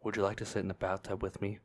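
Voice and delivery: low creepy voice cracking into laughter; creepy whisper. Acted. Replaying the creepy stranger's bathtub line, low voice breaking up. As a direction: try the low creep voice, then lose it. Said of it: "and whisper".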